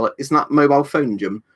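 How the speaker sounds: ordinary talk, nothing marked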